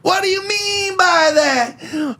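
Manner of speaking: very high, drawn-out wailing protest; high-pitched mock shouting, words stretched out; mock outraged wail. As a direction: imitate an indignant, shouting complainer at the top of your range. Mimicking clip's outraged caller.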